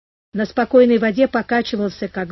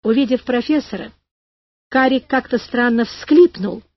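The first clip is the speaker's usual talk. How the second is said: usual speaking voice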